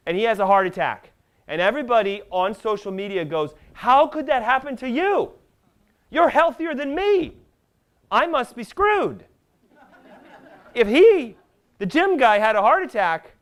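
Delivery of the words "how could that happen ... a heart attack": alarmed disbelief. Acted. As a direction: exclaim it like a stunned, worried onlooker. Acts out panicked social media reaction.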